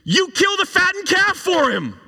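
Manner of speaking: put-on older son sneering at his father; sarcastic. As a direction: spit out the last line in a high, mocking voice. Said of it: "hear the sarcasm".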